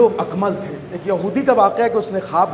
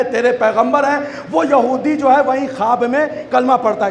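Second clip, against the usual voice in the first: raised, emphatic sermon voice; higher-pitched, insistent oratory; impassioned preaching; 6 semitones higher, 3 dB louder than usual. Real, not acted. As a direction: proclaim each short phrase to the congregation. Preaches the dream's climax.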